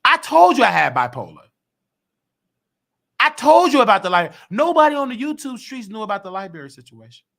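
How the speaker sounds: high and loud, pressing the point; loud, high-pitched defiance; loud insistence. Real, not acted. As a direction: hammer it home to the haters. Continuing heated rant.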